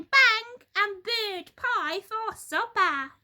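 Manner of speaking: high, loud hag voice building to bangs; spiteful old wife relishing the hunt; gleeful Missus Twit. A narrator's reading. Missus Twit plotting bird pie, high loud gleeful hag.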